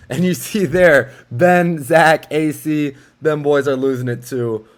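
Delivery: excited. Real, not acted. Laughing at favorite play.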